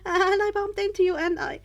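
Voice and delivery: high, loud, stammering and flustered; panicked apology. A narrator's reading. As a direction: trip over the words in a scared rush. Startled boy stammers an apology.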